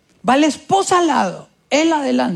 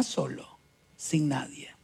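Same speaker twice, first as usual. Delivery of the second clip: ominous whisper; 8 semitones lower, 12 dB quieter than usual. Retold or imitated. Pastor warning the doctor he will end up alone, whispering. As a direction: drop to a breathy unvoiced whisper.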